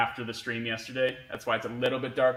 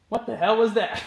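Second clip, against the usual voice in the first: startled; 8 semitones higher, 6 dB louder than usual. Real, not acted. Startled by noise at garage.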